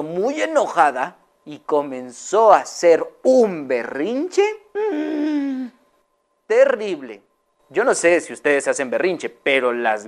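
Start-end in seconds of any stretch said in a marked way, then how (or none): 4.7-5.7 tantrum whine. Acted. Hen's tantrum sound.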